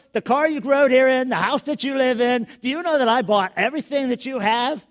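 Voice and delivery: loud, high, hammed-up indignation; mock outraged father lecturing his son; mock indignant rant. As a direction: rant at the son, half joking. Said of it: "I may have even said some of that".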